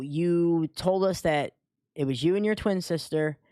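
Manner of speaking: normal talking voice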